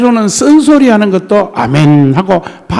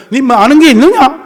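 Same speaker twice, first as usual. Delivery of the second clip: higher, louder stern rebuke; God scolding; 6 semitones higher, 4 dB louder than usual. Retold or imitated. God rebuking Job, stern challenge. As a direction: thunder down a challenge. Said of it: "야단을 쳐요".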